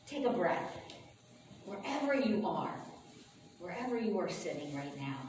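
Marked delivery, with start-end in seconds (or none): none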